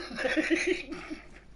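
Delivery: high, breathy burst of laughter. Real, not acted. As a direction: crack up at your own story. Laughs at own awkward story.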